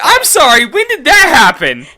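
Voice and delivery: loud, high-pitched disbelief; incredulous outburst. Real, not acted. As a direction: demand an explanation, appalled. Disbelief at friend's story.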